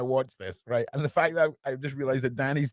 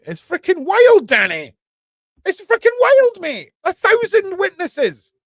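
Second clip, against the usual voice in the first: excited outburst; 7 dB louder than usual. Real, not acted. Excited outburst about the footage.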